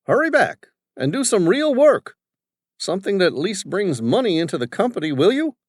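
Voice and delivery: put-on high, loud, bossy old businessman; domineering father. Father questioning his son's crew, bossy and loud. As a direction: voice the overbearing father barking at his staff.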